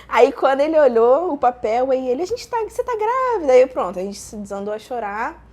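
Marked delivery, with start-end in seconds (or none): 2.2-3.5 high-pitched retold realisation; imitated astonishment. Partner reading the positive test, imitated astonishment. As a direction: act out a partner's startled discovery.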